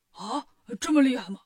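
loud, amazed question; astonished. A narrator's reading. Demon king believes Wukong's boast, astonished. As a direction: gasp it out in disbelief. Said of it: "赛太岁瞪大了眼睛".

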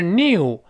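"new" with a strong y, "nee-ew"; too much glide. Shown as a demonstration. Teacher shows what it is not, strong-glide "new". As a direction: overdo the y in "new". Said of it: "不是new".

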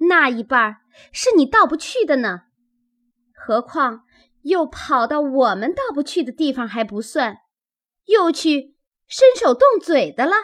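put-on high, quick, indignant young servants; aggrieved young maids. A narrator's reading. Young maids blamed for the intruder turn on her, aggrieved. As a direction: protest in a high, hurried voice. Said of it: "小丫头们都说".

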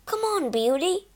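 high-pitched coaxing; urging. A narrator's reading. Groom to a horse stopped at the bridge, urging. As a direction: coax the horse onward. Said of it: "John urged".

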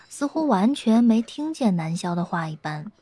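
ordinary delivery

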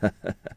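short, gentle character laugh; soft chuckle. A narrator's reading. Old Taoist greets the visiting official, soft chuckle. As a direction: give a small knowing chuckle. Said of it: "微微笑道".

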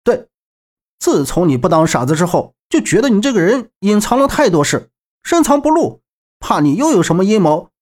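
accusation climbing in pitch; indignant, rising accusation; accusing shout. A narrator's reading. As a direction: confront someone you suspect of scheming. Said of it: "周震双手盘在胸前，喝道".

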